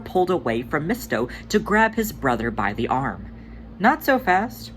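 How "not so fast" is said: playful stop. Acted. Tugger stopping his brother.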